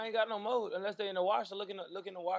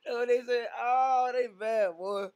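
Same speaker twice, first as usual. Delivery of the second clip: excited; 6 semitones higher, 8 dB louder, slower than usual. Real, not acted. Reacting to fans' comments.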